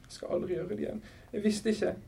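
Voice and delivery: high, soft little voice; tiny, subdued promise; small, meek. A narrator's reading. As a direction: plead meekly, barely daring to speak. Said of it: "Stemmen var liten og spak".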